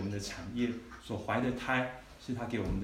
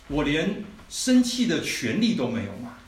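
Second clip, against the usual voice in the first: aggrieved; 3 semitones higher, 7 dB louder, slower than usual. Retold or imitated. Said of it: "他就很委屈啊".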